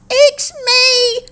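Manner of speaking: high, spluttered cry; spluttering. A narrator's reading. Ava pops out of the bush to calm her friends, spluttering. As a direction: blurt it out through leaves. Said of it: "spluttered Ava".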